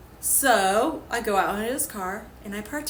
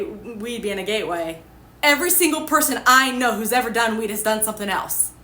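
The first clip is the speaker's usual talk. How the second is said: usual speaking voice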